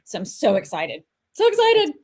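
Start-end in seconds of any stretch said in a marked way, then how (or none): 1.3-1.9 high squeal of excitement, louder; giddy high-pitched squeal. Real, not acted. Repeats her excitement, squealing.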